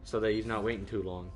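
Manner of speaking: ordinary talk, nothing marked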